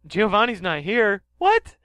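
high, loud startled professor; a flustered scientist knocked over, squeaking; Professor Sycamore voice. Acted. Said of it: "Hi, I'm Professor Sycamore".

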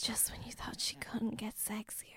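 trailer-style whisper; breathy mock trailer whisper. Mock trailer whisper about her.

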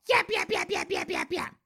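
high squeaky mock yapping; mocking screeching chicks. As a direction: parody drunk girls yapping like banshees. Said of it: "screeching like banshees".

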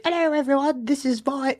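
very high, silly British impression; botched British accent. Mimicking the UK forum founder, botched British accent. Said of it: "that's not how he sounds".

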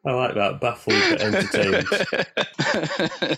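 amused slow laugh through words. Real, not acted. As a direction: chuckle the words out. Laughing at the story line.

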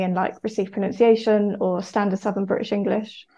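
usual speaking voice

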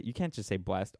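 ordinary talk

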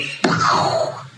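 short, breathy burst for a beheading; explosive mouth-made chopping sound; imitated chop noise. Storyteller mimics Shiva chopping his head off, sudden noise.

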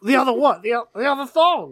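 high, loud retold disbelief; mock bafflement. Retelling a thong mix-up, hammed-up bafflement. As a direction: act out a baffled double-take. Acting out a comic misunderstanding.

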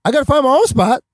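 high-pitched, disbelieving question; retold incredulous echo. Newcomer told to find his own spot, incredulous. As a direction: throw the order back in disbelief. Retold reaction to rival's order.

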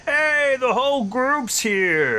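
put-on high, happy hello to old friends; excited greeting. [name] greets group.

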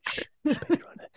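put-on soft shushing to a baby rhino; hushing. Parent hushing the toy rhino in its cage, soft shushing. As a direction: hush it like a sleeping baby. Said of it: "hushing a toy rhino".

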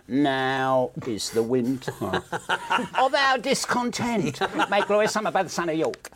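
slow, drawn-out theatrical Shakespeare recital; [name]. Acted. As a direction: declaim it slowly in a camp rock-star drawl. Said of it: "he sort of did this".

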